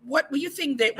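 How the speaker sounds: ordinary talk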